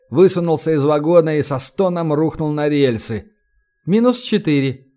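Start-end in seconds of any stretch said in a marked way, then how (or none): none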